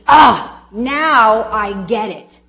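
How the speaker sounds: loud "Ah", then slow words; slow dawning realization. Shown as a demonstration. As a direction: drag out the moment of getting it. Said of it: "And that means".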